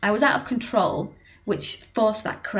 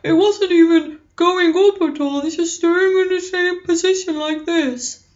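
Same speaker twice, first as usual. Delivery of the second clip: dopey voice, much higher and louder; goofy dopey voice pitched up; 8 semitones higher, 6 dB louder than usual. Shown as a demonstration. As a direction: keep the dopey sound while climbing in pitch. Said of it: "as you talk in a dopey voice".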